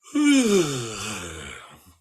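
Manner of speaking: loud drawn-out yawn-sigh. Shown as a demonstration. Coach demonstrating the combined yawn and sigh, loud. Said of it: "with the process of a yawn".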